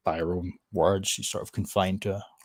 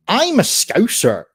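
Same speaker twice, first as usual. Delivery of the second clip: slow, higher mock voice of the woman; mocking her claim; 11 semitones higher, 9 dB louder, slower than usual. Streamer mocks the teacher's claim to be Scouse, slow and pitched up. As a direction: parody her drawn-out boast, pitched up. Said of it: "She doesn't even sound Scouse".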